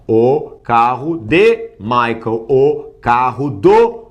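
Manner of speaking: slow, deliberate demonstration; measured teacher's translation, word by word. Shown as a demonstration. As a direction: give each word its own space.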